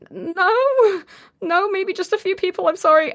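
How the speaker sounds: very high, hurried backpedalling; high-pitched flustered backpedal; flustered apology. Retold or imitated. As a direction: squeak out a panicked sorry. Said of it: "so overwhelmed at this point".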